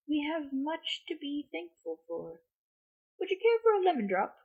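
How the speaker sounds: lightly voiced Dumbledore. A narrator's reading. Dumbledore offers sweet.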